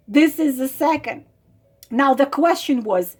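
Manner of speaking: normal talking voice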